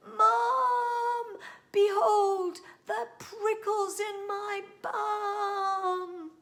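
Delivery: high, loud yell for mum, drawn out slowly; shouted. Acted. Child shouting to mum about prickles, loud and high. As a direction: bellow for help across the house. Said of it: "I shouted".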